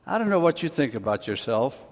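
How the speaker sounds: usual speaking voice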